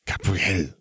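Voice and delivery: restrained, warning hiss of a name. A narrator's reading. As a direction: hiss it at your little sister without raising your voice. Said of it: "Fleur hissed".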